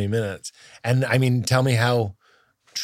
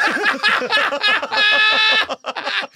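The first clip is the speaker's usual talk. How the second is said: high-pitched burst of laughter; 4 dB louder than usual. Real, not acted. Laughing at the bit.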